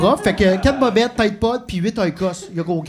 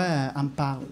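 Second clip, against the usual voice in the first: normal talking voice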